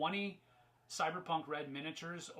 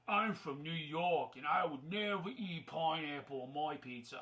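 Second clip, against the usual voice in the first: mock New Yorker that slides into an Australian accent; a stray Australian accent in a mocking quote; accidental Australian accent. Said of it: "I don't know why that went Australian".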